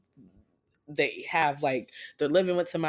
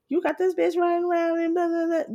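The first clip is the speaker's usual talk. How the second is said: high, fast, louder mock rant at a cheating man; 13 semitones higher, 3 dB louder, faster than usual. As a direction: squeal the accusation in quick mockery. Ranting at him, retelling quarrel.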